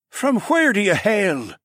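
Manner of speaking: high, slow lizard-man voice; piping up. Acted. Lizard man questioning the newcomer, high piping voice. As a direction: pipe up from the crowd, high and slow. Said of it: "someone else piped up".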